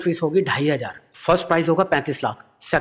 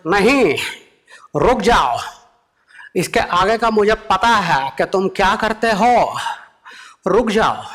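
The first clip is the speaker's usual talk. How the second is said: louder, higher, slow stern voice; Modi impression; 7 semitones higher, 3 dB louder, slower than usual. Modi stopping the comedian, loud and stern. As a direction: cut him off with firm authority.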